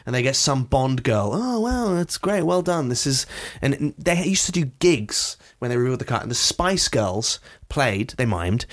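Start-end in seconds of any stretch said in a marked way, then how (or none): 1.3-2.9 high-pitched mock-impressed reaction; mock amazement. Imitating crowd at a car reveal, mock amazement. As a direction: feign polite wonder, pitched up. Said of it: "everyone goes".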